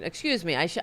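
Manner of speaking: normal talking voice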